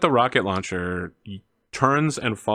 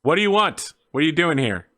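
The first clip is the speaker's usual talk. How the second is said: raised, high-pitched demand; challenging guard; 5 semitones higher than usual. Acted. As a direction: demand answers from an intruder. Guard challenges the approaching group.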